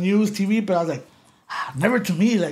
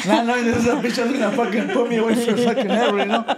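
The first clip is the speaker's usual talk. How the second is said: laughing it out, higher; 6 semitones higher, 3 dB louder than usual. Real, not acted. Ex-con telling the host about the agent, laughing hard. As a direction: laugh through the irony. Laughing at his own naivety.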